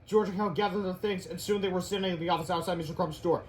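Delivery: ordinary delivery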